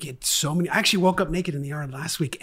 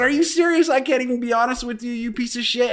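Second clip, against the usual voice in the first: retold angry outburst; 7 semitones higher than usual. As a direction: hurl it at him, outraged. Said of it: "I said".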